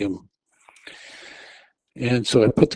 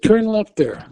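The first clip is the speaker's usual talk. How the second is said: high, loud command; urgent yelling; 10 semitones higher, 4 dB louder, slower than usual. Retold or imitated. Passenger yelling turn at diabetic driver, high urgent command. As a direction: bark the turn.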